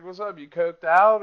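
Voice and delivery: wired, high-pitched messenger god; Hermes. Acted. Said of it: "he just pops real fast like this".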